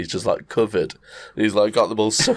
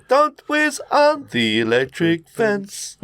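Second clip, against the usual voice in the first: higher, slowed cartoon voice; cartoon game title; 10 semitones higher, slower than usual. Naming his favourite cartoon game, lightly voiced. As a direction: sing out the game name like a kid.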